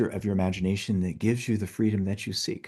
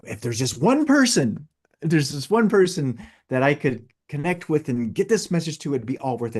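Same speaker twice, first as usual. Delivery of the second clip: passionate quote; 6 semitones higher, 4 dB louder than usual. Retold or imitated. Quoting the video's passionate ending.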